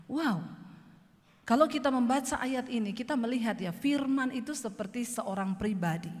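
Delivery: ordinary talk, nothing marked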